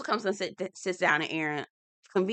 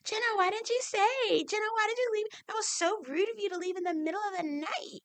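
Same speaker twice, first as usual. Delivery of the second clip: high nasal-free mock whine, much higher; whiny mock complaint; 4 dB quieter than usual. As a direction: ham up a petulant complaint, high-pitched. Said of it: "Apparently, it was a problem".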